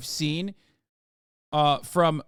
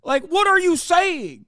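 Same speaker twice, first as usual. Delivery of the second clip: exasperated shout; 11 semitones higher, 6 dB louder, slower than usual. Real, not acted. Incredulous shout at bigots.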